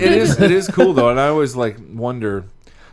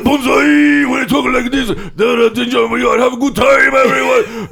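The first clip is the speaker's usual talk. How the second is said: high, hammed-up drunk Japanese accent; drunk Japanese businessman; 9 semitones higher than usual. Shown as a demonstration. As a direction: shout out a tipsy mock Japanese toast, opening drawn out. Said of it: "that's a drunk Japanese".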